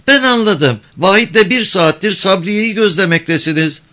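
put-on high-pitched, pert girl's voice; high young voice standing up to a man; defiant village girl. A narrator's reading. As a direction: challenge him in a girl's high voice.

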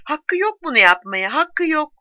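a narrated shout of protest. A narrator's reading. Woman grips Matthew's shoulders and protests, shouting. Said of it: "omuzlarına yapışarak bağırdı".

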